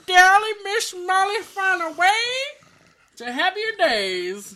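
high, loud showbiz host sign-off; mock announcer. Host hamming up the show's sign-off. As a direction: sell it like a cheesy TV host.

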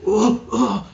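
high loud groaning of a beaten orc; pained groans. Acted. Chained orc groans in pain, loud high grunts. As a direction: groan like you've been beaten. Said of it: "He's like".

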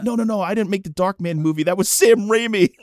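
lightly acted flustered celebrity; hurried correction. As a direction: rush to set an excited fan straight. Acting flustered Tarantino correcting the fan.

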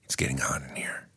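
quick low whisper. Acted. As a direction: rush it out in a whisper. Jesus whispering song line.

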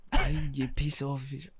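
put-on hesitant stab at a Scottish accent; attempted Scottish accent. Co-host goaded into the movie quote, attempted Scottish accent. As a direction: start a Scottish accent, then give up. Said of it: "I'm not gonna do the accent".

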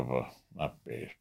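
low dismayed mumble to himself; muttering to himself. Retold or imitated. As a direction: groan it privately. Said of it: "Sag ich mir".

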